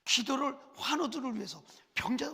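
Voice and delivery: usual speaking voice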